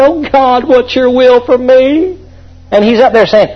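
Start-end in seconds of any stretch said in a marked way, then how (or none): 0.0-2.2 pleading churchgoer. Retold or imitated. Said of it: "a lot of you are saying".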